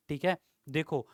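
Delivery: usual speaking voice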